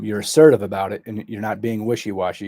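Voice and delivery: ordinary talk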